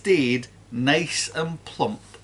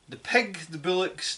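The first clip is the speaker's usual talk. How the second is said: usual speaking voice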